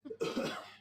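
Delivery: a single dry cough. Acted. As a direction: cough once into the storm. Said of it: "sound of your cough in the snowstorm".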